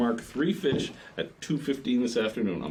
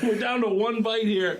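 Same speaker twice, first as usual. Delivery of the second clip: high, slow exclamation through laughter; worked-up scolding; 13 semitones higher, 4 dB louder than usual. Retold or imitated. Captain retelling scolding of banana guest, worked-up. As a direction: replay a heated telling-off, amused. Retold scolding after the strike.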